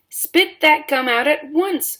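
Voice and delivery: loud, high, slow command; ordered. A narrator's reading. Father orders Violet to spit out gum, loud and slow. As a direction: bark the order at her. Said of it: "ordered Mister Beauregard".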